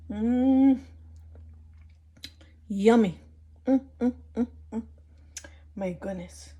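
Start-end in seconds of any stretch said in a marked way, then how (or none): 0.1-4.8 savoring mm with mouth full; appreciative hums while tasting; eating soup. Real, not acted. Tasting the soup just made.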